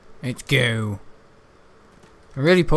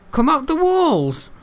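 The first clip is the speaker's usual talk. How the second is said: high slow loud taunt; taunting call; 12 semitones higher, 4 dB louder, slower than usual. Acted. Gamer taunting hidden ogre, high drawn-out call.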